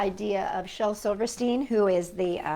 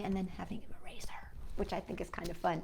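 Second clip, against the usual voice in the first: whispered aside; 6 semitones lower, 17 dB quieter, faster than usual. Acted.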